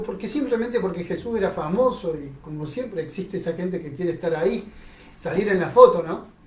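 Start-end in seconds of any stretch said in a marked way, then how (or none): none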